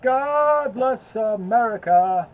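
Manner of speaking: slow, high, grand proclamation; hammed-up patriotic cheer. Celebrating wife's citizenship.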